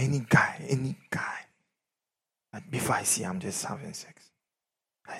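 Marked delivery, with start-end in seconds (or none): 0.0-1.5 whispered quote. Retold or imitated. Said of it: "He said".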